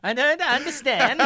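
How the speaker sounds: high, loud, puzzled foreigner voice; baffled foreign soldier. Acted. Scandinavian soldier who can't follow the orders, high baffled voice. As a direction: play it bewildered, stretching the words.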